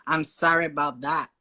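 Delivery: American "sorry", opened to "sah-ree"; American accent. Shown as a demonstration. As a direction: say "I'm sorry about that" like an American. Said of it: "she said Americans, they say more like".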